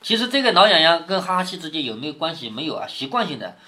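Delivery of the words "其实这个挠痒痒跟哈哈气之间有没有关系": normal talking voice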